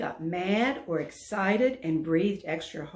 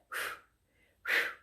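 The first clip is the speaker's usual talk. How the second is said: puffing; 3 dB quieter than usual. Acted. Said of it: "trying to blow smoke like Dexter".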